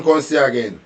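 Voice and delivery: ordinary talk, nothing marked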